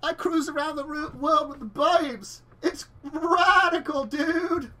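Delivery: high, slow surfer dude hype; extreme kids ad. Acted. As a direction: sell it like a radical ad. GM voices surfer yacht owner.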